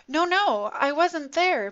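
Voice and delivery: high, loud panicked denial; desperate cry. Acted. Peter denies knowing Jesus to the guards, desperate and loud. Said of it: "Peter cried desperately".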